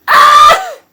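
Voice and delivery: short, very high, loud shriek; thrilled scream. Real, not acted. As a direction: let out a piercing cry of delight. Thrilled scream after praise.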